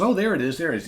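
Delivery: captain spotting the little boat; excited discovery. Acted. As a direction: point and cry out with relief. Captain spots the dory.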